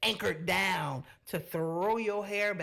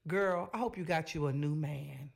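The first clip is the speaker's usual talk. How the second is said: usual speaking voice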